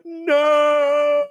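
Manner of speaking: very high, hammed-up wail of denial; mock Trumper despair. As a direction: wail it like a fan whose world just ended. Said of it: "they'll be like".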